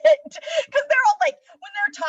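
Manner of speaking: high laughing squeal. Real, not acted. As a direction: laugh the words out. Laughing mid-sentence.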